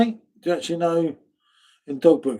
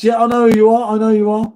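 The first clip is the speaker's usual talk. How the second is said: raised voice, insisting; loud; 6 semitones higher, 6 dB louder, faster than usual. Real, not acted. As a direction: repeat it louder, insisting. Insisting loudly to a viewer.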